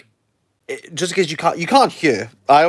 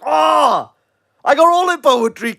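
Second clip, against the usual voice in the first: high, shouted mock enthusiasm; mock boasting; 12 semitones higher, 4 dB louder than usual. Everyone pretending to be poetry experts, mock-excited shout. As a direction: cry out like an instant expert.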